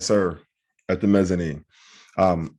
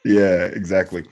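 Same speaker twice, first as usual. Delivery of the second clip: ordinary talk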